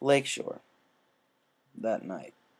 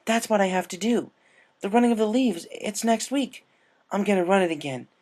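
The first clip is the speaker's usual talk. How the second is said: higher, louder determined excitement; eager; 9 semitones higher, 4 dB louder than usual. A narrator's reading. Rainbow tells Applejack her new plan, eager and loud. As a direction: announce the plan with fresh energy.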